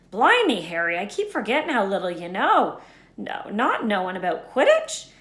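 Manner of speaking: half-play Hagrid, amazed Harry knows so little; Hagrid voiced. A hint of Hagrid exclaiming to Harry.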